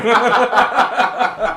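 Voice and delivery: big bright burst of laughter; loud high laugh. Real, not acted. Laughing at the joke.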